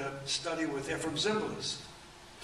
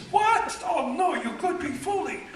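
much higher and much louder imitated voice, laughing; the little man outraged, pitched way up; 9 dB louder than usual. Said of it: "He says".

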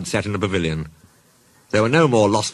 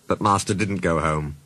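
plain reading voice

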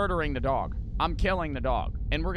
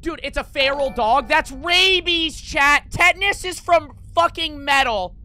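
loud and much higher, correcting chat in disbelief; exasperated rant; 12 semitones higher, 11 dB louder than usual. Real, not acted. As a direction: shout down a wrong answer, fed up. Ranting at chat over tetanus.

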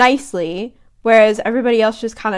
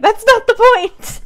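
high, loud exasperation; exasperated; 12 semitones higher, 6 dB louder than usual. Retold or imitated. Mum exasperated at husband, high and loud. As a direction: throw up your hands.

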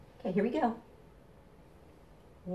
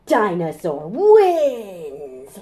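triumphant; 11 dB louder, much slower than usual. Acted. Triumphant refrain.

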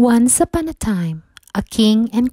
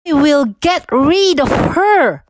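higher, louder snarled threat; snarling queen; 7 semitones higher, 4 dB louder than usual. A narrator's reading. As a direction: bite out the vow in fury. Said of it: "she snarled".

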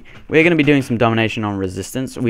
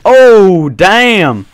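loud, high shocked exclamation; startled outburst; 9 semitones higher, 12 dB louder than usual. Real, not acted. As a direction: cry out as you suddenly get taken down. Gets knocked down in the game.

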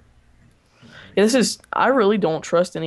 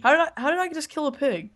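surprised; 9 semitones higher, faster than usual. Real, not acted. Astonished at own kill.

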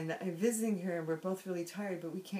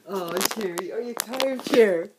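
teasing laugh; 4 semitones higher, 16 dB louder than usual. Real, not acted. Teasing sister who was snoring.

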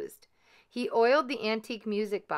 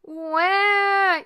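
put-on very high, loud baby "Wah!"; baby ghost startled by the shouting, wailing; alarmed baby wail; 12 semitones higher, 10 dB louder than usual. A narrator's reading. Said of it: "yelled Baby Ghost, alarmed at the noise".

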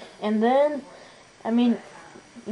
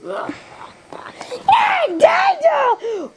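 very high, squealed holler; high calling out; much slower than usual. Acted. Character calling to Daniel, high mock shout. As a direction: holler the name in a high screech.

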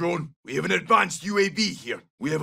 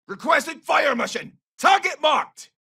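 high-pitched combat shout; urgent fire request; 9 semitones higher than usual. Acted. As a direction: call the strike in over battle noise. Calling in fire support.

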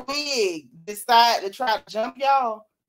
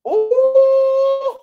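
high ooh; 9 dB louder than usual. Real, not acted.

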